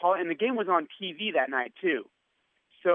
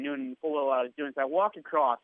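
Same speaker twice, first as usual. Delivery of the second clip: usual speaking voice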